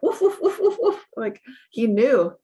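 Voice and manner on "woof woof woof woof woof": high laughing woof woof; excited dog barks. Retold or imitated. Telling the host about her dog, laughing high barks. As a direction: bark like an overjoyed dog. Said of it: "would go bananas, like".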